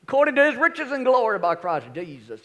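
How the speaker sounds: a touch of Southern gospel preacher. Shown as a demonstration. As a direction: carry the verse on in old revival style. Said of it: "in the old Southern gospel voice".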